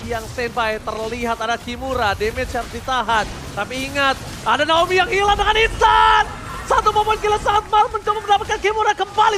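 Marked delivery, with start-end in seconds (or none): 4.4-9.4 high, loud hype call; excited caster. Real, not acted. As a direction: rise with the teamfight.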